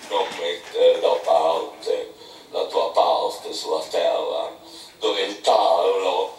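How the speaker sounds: put-on an old captain grumbling over his book, low and slow; muttering old Bildad. A narrator's reading. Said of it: "ma continuò a borbottare tra sé".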